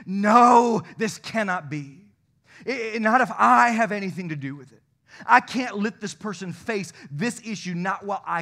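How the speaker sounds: loud, indignant outburst, fading at the end; indignant Jesus. Retold or imitated. Preacher voicing Jesus, loud indignant refusal. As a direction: voice Jesus refusing to let the leper suffer. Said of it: "Jesus was indignant".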